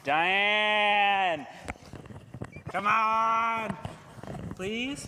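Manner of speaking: loud, high call across the room. Real, not acted. Host calls Diane up from the crowd, loud and high. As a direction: shout her name up to the stage. Calling volunteer up to stage.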